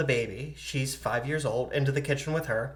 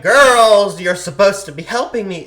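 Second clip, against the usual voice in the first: put-on loud, raised-pitch call to the kids; father's scolding yell; 6 semitones higher, 15 dB louder than usual. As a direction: holler at the girls, exasperated. Father scolding his daughters.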